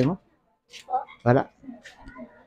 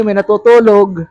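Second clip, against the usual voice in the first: normal talking voice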